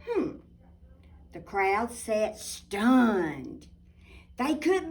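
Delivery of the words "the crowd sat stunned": plain reading voice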